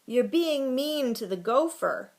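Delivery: high, loud objection; girl's protest. A narrator's reading. Girl defending the gopher from the boys, protesting. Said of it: "protested a girl".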